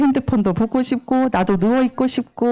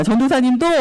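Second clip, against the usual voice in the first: ordinary talk, nothing marked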